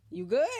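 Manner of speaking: YOU GOOD high and loud, rising; offering a hit. Acted. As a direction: offer it with a punchy lift on GOOD. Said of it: "I want you to hit".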